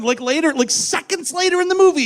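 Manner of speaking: usual speaking voice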